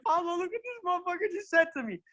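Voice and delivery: high, squeaky disbelief; hammed-up astonished confiding; incredulous aside. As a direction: re-enact telling a friend in amazement, voice held high. Acts out disbelief to his friend.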